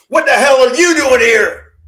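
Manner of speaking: high, loud and slow angry yell; furious shout. Retold or imitated. Dad in his underwear yelling at Shane, furious shout. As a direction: roar it at the stranger in your house. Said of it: "he's going".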